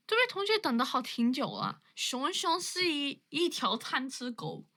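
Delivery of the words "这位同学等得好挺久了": ordinary talk, nothing marked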